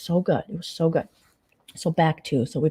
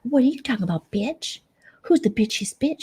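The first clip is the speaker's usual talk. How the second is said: mock catty voice; 7 semitones higher than usual. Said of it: "Brooke's like".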